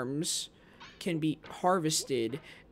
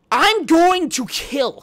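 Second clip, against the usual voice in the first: screamed much higher and louder, slowly; furious scream; 12 semitones higher, 13 dB louder, slower than usual. Real, not acted. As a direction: let the fury explode. Rage at the game.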